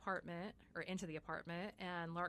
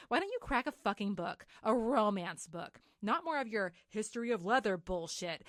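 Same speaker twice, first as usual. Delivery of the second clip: louder, punchy mock tirade; scathing telling-off; 3 semitones higher, 9 dB louder than usual. Reading a friend's angry text, scathing. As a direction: hammer out the insult with relish.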